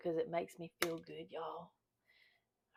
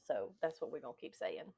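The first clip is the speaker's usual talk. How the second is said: ordinary talk, nothing marked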